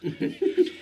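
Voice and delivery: a quick squealing laugh at himself; high laugh. Real, not acted. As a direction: burst of high-pitched laughter. Laughs at own bravado.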